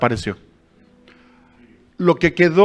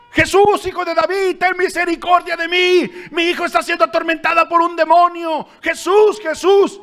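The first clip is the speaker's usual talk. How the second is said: high, pleading cry of a desperate woman; mujer suplicando a gritos; 11 semitones higher than usual. Retold or imitated. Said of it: "una mujer le empieza a gritar". (mujer que grita suplicando, mucho más aguda)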